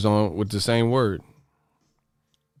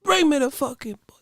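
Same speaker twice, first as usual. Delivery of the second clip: very high mock Cuban accent; Yoel Romero. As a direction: imitate a thick Cuban accent in a pitched-up voice. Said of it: "Remember when Yoel Romero said".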